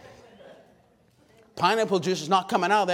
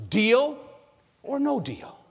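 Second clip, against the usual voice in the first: Deal or no deal pitched up, ending high; rising game show host; 8 semitones higher, slower than usual. Retold or imitated. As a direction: tease them with the host's big question. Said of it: "what Howie Mandel says".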